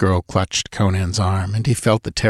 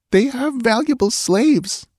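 higher, slow, lightly voiced murmur; murmuring girl. A narrator's reading. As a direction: say it slowly, half to herself. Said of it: "murmured the girl".

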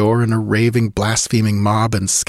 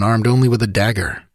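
plain reading voice

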